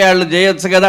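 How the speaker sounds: ordinary delivery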